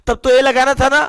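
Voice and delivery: usual speaking voice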